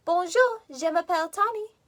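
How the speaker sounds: "Bonjour" lifted high, "je m'appelle Tony" in French sounds; French greeting. Shown as a demonstration. Teacher repeating her French greeting for the kids. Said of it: "That means".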